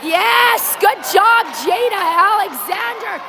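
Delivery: high shouted name; yelling from the sidelines. Real, not acted. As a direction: call the player across the ice. Calling a player's name.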